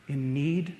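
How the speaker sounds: low, soft, falling away; quiet, weighty ending with a pause; hushed solemn close. Acted. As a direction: let the last words sink almost to nothing.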